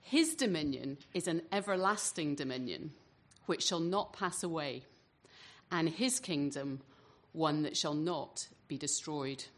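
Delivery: ordinary delivery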